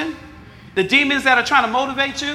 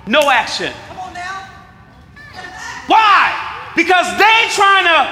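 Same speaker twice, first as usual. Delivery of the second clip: high, loud preacher's climax; full-force preaching; 7 semitones higher, 3 dB louder than usual. Acted. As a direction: preach it hard and rising.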